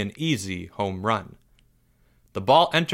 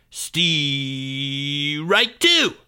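yelled call; 3 semitones higher than usual. Acted. Kid yelling the second strike to tease the batter. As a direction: drag it out then blast the last word. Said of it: "yelled Greg".

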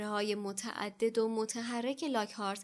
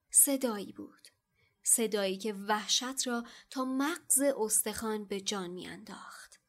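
ordinary delivery

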